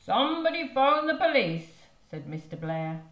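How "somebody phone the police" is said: high, loud urgent cry; urgent call. Acted. Father calling for the police, loud urgent cry.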